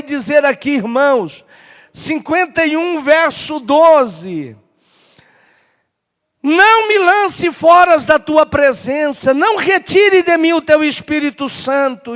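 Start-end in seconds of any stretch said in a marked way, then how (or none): 6.4-12.1 raised, emphatic pulpit reading; declaimed scripture reading. Real, not acted. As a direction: proclaim the verse as a plea to God. Reads the psalm aloud.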